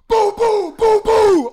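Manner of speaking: high, loud booming pops; popping tires. Retold or imitated. Kid imitating all four tires exploding, loud popping booms. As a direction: blast each pop out.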